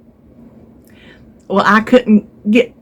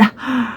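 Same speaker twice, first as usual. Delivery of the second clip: shocked gasp; 4 semitones higher, 8 dB quieter than usual. Retold or imitated. Learns she was tricked.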